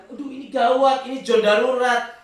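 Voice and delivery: loud, high alarmed doctor. Retold or imitated. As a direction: sound the alarm like a worried doctor. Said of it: "Dokter bilang". (dokter yang panik berseru keras)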